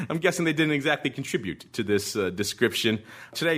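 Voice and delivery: ordinary talk, nothing marked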